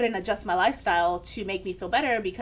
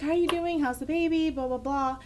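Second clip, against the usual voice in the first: high-pitched, singsong imitation of a doctor's greeting; imitated polite small talk, pitched up; mock friendly doctor; 6 semitones higher than usual. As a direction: chirp the routine questions, then tail off. Voicing doctor's routine greeting.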